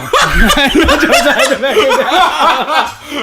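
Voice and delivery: high, loud, drawn-out laughing protest; real laughter stretching the words; laughing confession. Real, not acted. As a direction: crack up while defending yourself. Confesses while cracking up.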